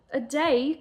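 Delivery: Corey crying out in disbelief at the wait. Acted. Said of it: "cried Corey, her sobs becoming louder".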